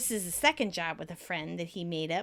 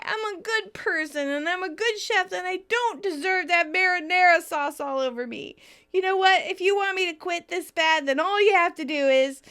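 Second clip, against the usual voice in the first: tearful; 9 semitones higher, 5 dB louder than usual. Retold or imitated. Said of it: "Monica starts to cry".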